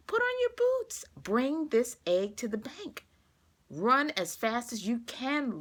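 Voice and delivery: high, urgent pleading; begging wife. Acted. June begging Luke to rush the egg to the bank, pleading. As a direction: push him out the door, pleading. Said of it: "begged June".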